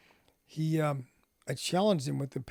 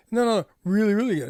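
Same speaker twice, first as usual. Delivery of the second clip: higher, louder, eagerly insisting; eager insistence; 6 semitones higher, 7 dB louder, faster than usual. Retold or imitated. Coworker insists to Marshall, louder and higher, excited insistence. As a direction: push back with excited no no no.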